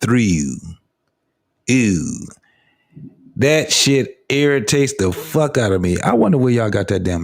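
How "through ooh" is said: Baltimore "do", "through", "ooh", each vowel drawn out and falling; mock Baltimore accent. Host mocking words that rhyme with do, Baltimore accent. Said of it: "when y'all say anything that rhymes with".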